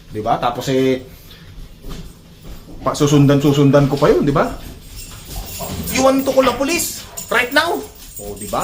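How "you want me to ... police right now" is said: much higher, challenging pretend threat; pitched-up mock threat to call police. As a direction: dare the thief to stay. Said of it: "Sasabihin kong gano'n".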